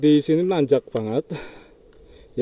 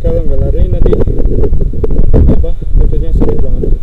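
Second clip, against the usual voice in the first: loud voice raised over strong wind; shouting over wind; 2 semitones higher, 11 dB louder, faster than usual. Real, not acted. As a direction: call out loudly against the gusts. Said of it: "Di sini anginnya besar banget ya. Pasti kedengeran".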